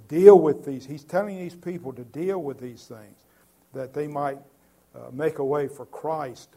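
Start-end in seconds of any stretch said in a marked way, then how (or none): 0.1-0.9 urgent command. Retold or imitated. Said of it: "he says".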